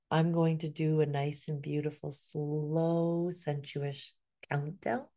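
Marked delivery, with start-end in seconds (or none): none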